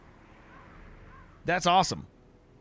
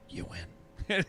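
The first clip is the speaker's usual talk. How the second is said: whispered flat game voice; 6 semitones higher, 9 dB quieter than usual. Retold or imitated. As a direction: breathe it out, deadpan. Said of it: "the game's just like".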